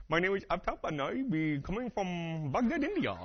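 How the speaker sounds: put-on Indian accent, slow and lilting. Shown as a demonstration. Preacher showing off his little Indian accent. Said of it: "I can do my little Indian accent".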